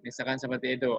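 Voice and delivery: ordinary talk, nothing marked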